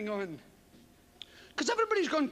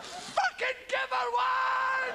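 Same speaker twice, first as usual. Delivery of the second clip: much higher, slow bellow; frustrated yell; 11 semitones higher, much slower than usual. Real, not acted. Heckling the polite film lover, slow frustrated yell. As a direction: bellow at the screen.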